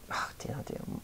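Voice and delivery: whispered under her breath; whispering. Real, not acted. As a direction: hush it to herself. Muttered self-correction.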